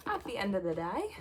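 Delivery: a slip into a light Australian accent. Real, not acted. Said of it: "sorry for that Australian accent".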